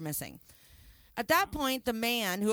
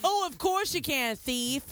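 mock shop clerk; 5 semitones higher than usual. Clerk replying to the thief.

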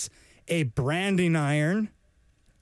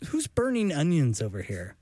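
baffled shout. Pretend. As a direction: react like you smelled something awful. Imagined person smelling fart spray.

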